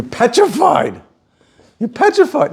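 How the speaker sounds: high-pitched mock fright; mock petrified. Teacher tells student about a loose dog, mock petrified.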